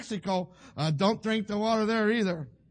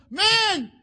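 high, loud cry of surrender; exasperated Pharaoh; 11 semitones higher, 9 dB louder than usual. Acted. Preacher plays Pharaoh giving in to Moses, loud exasperation. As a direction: shout it like you can't take any more. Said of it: "finally he says".

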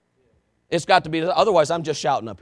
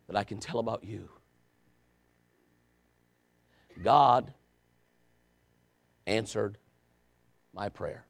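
low, soft and halting; quiet, lowered pulpit voice; hushed confession; 7 semitones lower, 7 dB quieter than usual. Real, not acted. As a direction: confess it solemnly, with long pauses. Solemn confession of failed prayer.

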